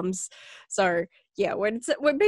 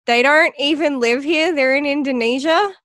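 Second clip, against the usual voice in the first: louder exasperated correction; 7 semitones higher, 5 dB louder than usual. Retold or imitated. Correcting a foreigner's list, exasperated and louder. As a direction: set the record straight, fed up.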